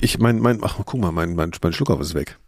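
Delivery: ordinary talk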